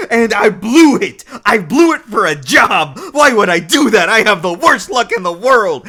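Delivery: high, loud hammed-up lament; mock despair. Ryo cursing his lost chance, loud hammed-up rant. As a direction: rant at your bad luck.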